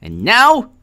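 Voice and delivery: normal talking voice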